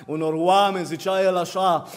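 ordinary talk

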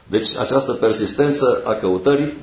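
usual speaking voice

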